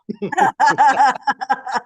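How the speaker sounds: loud-edged, high burst of laughter; real laughter. Real, not acted. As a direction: crack up at your own dare. Laughing at own dare.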